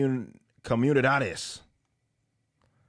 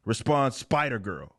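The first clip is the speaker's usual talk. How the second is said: usual speaking voice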